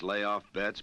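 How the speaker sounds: normal talking voice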